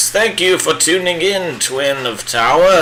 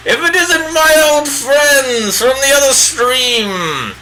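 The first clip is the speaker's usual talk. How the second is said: higher, hammed-up British old wizard; bad Gandalf impression; 7 semitones higher than usual. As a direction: play a pompous old wizard, badly on purpose.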